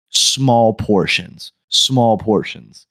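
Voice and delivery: mock customer spelling out instructions slowly; slow-ass talking. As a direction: stretch each word as if to a child. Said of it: "like, slow ass talking".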